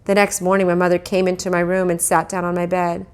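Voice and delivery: ordinary delivery, nothing marked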